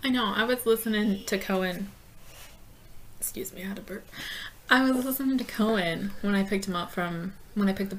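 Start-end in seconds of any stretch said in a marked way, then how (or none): 3.2-4.1 whispering. Real, not acted. Apologising for a burp.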